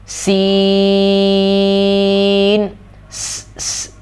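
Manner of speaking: سین with a long whistling s; whistling seen. Shown as a demonstration. Teacher demonstrating whistling س. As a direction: hiss the س hard. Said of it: "سیٹی والی آواز آئے گی".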